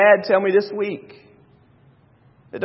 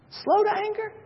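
Moses amazed; 14 semitones higher than usual. Retold or imitated. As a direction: gasp it out as Moses, stunned. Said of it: "You can only imagine that Moses is going".

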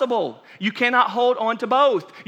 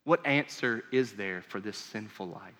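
hushed prayer; 12 semitones lower, 11 dB quieter than usual. Acted. Sinner's prayer voiced by preacher.

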